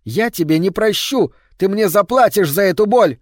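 high, loud threat; vengeful shout. A narrator's reading. Creature screams vengeance at Solange, high and loud. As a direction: threaten her with fury. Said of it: "повернулась и крикнула".

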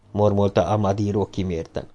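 plain reading voice

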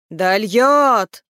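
loud, long swelling cry, falling away. A narrator's reading. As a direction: repeat it as a bigger drawn-out call. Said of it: "повторил громче".

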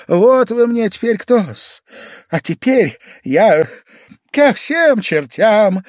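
high, loud, slow declaration; Pavel Pavlovich, drunkenly emphatic. A narrator's reading. Drunk guest declaring himself off, high and emphatic. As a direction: drag each phrase out loudly.

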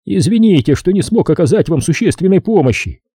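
высокий голос засыпающего доктора; скажи за доктора высоко, чуть сонно; сонный доктор. A narrator's reading. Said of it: "сонно добавил Осбертсон". (сонным высоким голосом)

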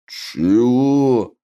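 long, drawn-out orc bellow; indignant roar of a brutish orc. A narrator's reading. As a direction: roar it out as one stretched word. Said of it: "заревел тот".

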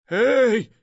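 sharp high shout of hey; calling out. Acted. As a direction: call after the thief loudly. Aref Bey calls out to thief.

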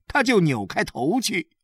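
ordinary delivery

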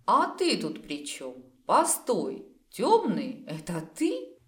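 incredulous, accusing questions; dawning suspicion. A narrator's reading. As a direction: break off as it dawns on you. Realises he is the culprit.

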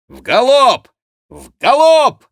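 very loud, high rider's order; shouted command. A narrator's reading. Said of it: "приказал гасконец". (громкий приказ всаднику)

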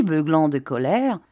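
ordinary delivery, nothing marked

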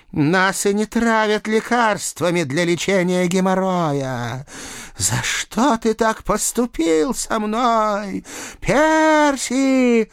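high, wailing cat voice; slow, high plaintive cat; reproachful talking cat. A narrator's reading. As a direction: stretch the reproach into a feline wail. Talking cat reproaches her killer.

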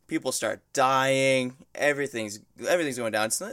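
ordinary talk, nothing marked

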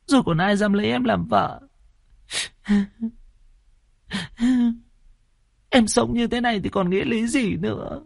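put-on high, tearful lament of a wronged girl; crying young woman.

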